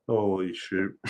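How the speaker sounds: usual speaking voice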